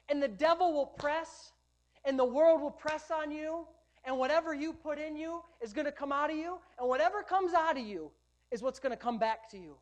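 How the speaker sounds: loud, driving sermon voice, building; full-force preaching. Acted. Preacher warning the congregation about the devil, loud.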